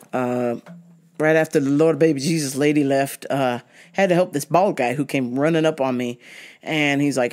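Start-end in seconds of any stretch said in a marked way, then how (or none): none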